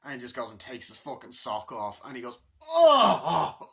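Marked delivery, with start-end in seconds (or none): 2.6-3.7 very high, loud oohs, chuckling; hammed-up relief. Salesman peels off his sock, very high loud delight. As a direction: overdo the blissful groan.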